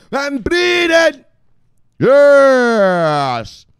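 high, drawn-out shout; hyped shout-out. Pretend. Hailing a viewer who joined the show, hyped shouting. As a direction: stretch the name into a gleeful holler.

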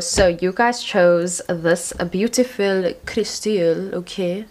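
slow, hammed-up French accent; a deliberately bad French accent, lightly done; mock French accent for a card name. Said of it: "French accent. ... that was so bad".

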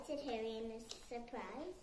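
plain reading voice

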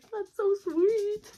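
high, slow, gushing coo. Retold or imitated. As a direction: coo it like it melts your heart. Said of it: "I'm like".

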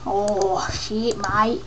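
broad Australian "mate"; Australian accent. Shown as a demonstration. Showing how Australians sound, broad accent. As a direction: demo a typical Australian grumble. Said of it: "that's what we frickin' sound like".

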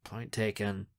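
low, soft mumble; mumbling. A narrator's reading. Narrator concedes while picking up paintballs, mumbling. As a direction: mumble it under your breath. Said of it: "and I mumble".

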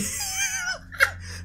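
high shrieking laugh; cry-laugh after a scare. Real, not acted. Laughs after being jump scared.

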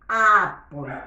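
Apple said slowly and loudly as "A-pol"; teacher models Apple for the class, loud and clear; model pronunciation. Shown as a demonstration. Teacher shows children how to say Apple, loud and clear. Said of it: "Acá dice apple".

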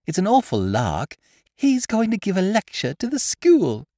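mischievous delight; gleeful schoolgirl. A narrator's reading. Child voice.

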